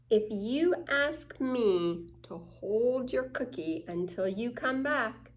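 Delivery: plain reading voice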